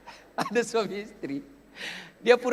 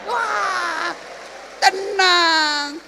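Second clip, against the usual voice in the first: high, loud drawn-out cry; hammed-up loud sigh of contentment; exclaiming relief; 8 semitones higher, 6 dB louder, much slower than usual. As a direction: belt out the blissful relief. Exclaims after his own song.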